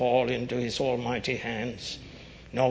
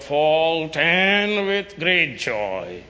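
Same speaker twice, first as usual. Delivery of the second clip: higher, louder ringing doxology; preaching at full force; 6 semitones higher, 6 dB louder than usual. Acted. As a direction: proclaim each word with rising force.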